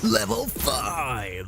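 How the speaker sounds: slow, drawn-out announcer cry, held back in volume; nineties game announcer. Shown as a demonstration. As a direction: stretch it like a retro game announcer. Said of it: "that's the energy I'm going for".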